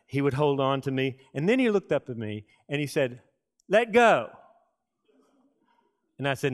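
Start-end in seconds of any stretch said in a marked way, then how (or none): 3.7-4.3 put-on higher, louder little kid voice; a small boy insisting he be let go; little son's demand. Child demanding in the wave pool.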